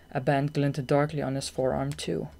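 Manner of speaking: ordinary delivery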